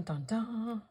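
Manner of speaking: ordinary delivery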